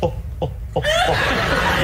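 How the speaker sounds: breathy oohs sliding up high; lightly crooned pop-star sighs. Retold or imitated. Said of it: "I'm doing [name]".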